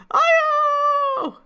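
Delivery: very high loud cheerful cry; bubbly character greeting. Acted. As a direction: cheer her name like an anime girl.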